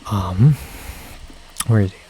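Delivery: normal talking voice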